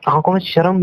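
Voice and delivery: usual speaking voice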